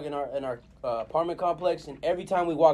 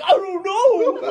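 high cartoon voice dissolving into laughter; goofy cartoon dodge, laughing; 4 dB louder, much slower than usual. Answering as Goofy in the meme, high and laughing. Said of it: "He's like".

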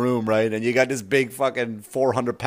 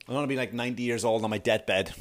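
usual speaking voice

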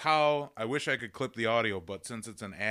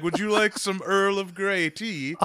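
mock British server; 10 semitones higher, 8 dB louder than usual. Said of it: "it's gonna be like".

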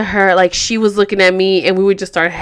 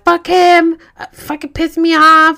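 high, loud mock outrage; pissed-off retold rant; 9 semitones higher, 5 dB louder than usual. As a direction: vent it to a friend, pitched up. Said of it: "our dudes pissed us off".

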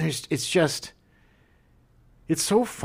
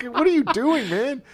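exasperated; 13 semitones higher than usual. Retold or imitated. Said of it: "mock exasperated question".

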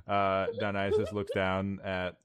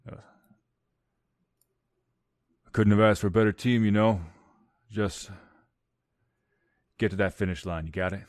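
dying hero's farewell; 6 dB louder, faster than usual. Acted. Dying NPC farewell to party.

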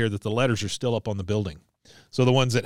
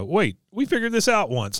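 playful excitement; 9 semitones higher than usual. Retold or imitated. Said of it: "Like it's a fun game".

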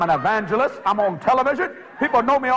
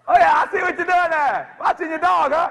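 teasing neighbor; 7 semitones higher than usual. Retold or imitated.

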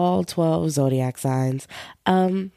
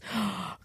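shocked gasp; 2 semitones higher, 12 dB quieter than usual. Real, not acted. Reacting to bad horoscope.

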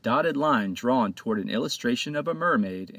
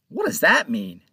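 demanding; 4 semitones higher, 5 dB louder than usual. A narrator's reading. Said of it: "Panto demanded".

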